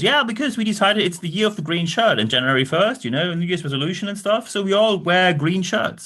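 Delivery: high, airy mock board member, far above own pitch. Board member announcing green shirts to staff, mock high executive voice. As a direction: send up a pompous executive with a lifted voice. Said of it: "someone from the board says".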